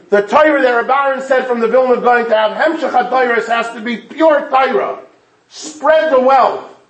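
high, loud rallying call; rousing preaching. Acted. Rabbi rallies the congregation to learn from the shul, preaching loud and high. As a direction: urge the whole congregation onward.

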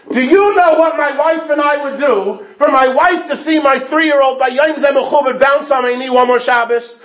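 high, loud pleading cry; impassioned outcry. Acted. Grieving father cries out to the shiva guest, high and loud. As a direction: let the father's grief burst out at the guest.

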